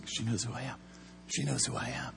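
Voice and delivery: hushed frightened repetition; stunned awe. Retold or imitated. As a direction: murmur it as if frozen in shock. Spooked cop repeating in shock.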